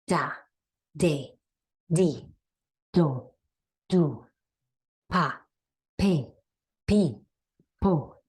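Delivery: each syllable spaced, falling, for echo; syllable drill. Shown as a demonstration. Teacher drilling syllables for students to repeat. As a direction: drill them one by one with pauses. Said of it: "Repeat after me".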